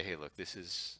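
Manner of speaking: softly, as if sharing a secret; a soft, low-volume plea to a caller; hushed confiding. Retold or imitated. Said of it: "And I'll say".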